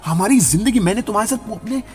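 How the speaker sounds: high, pained accusing outburst; a husband's high, heartbroken accusation of his wife; hurt accusation. Acted. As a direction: cry out that she betrayed him. Husband confronts cheating wife.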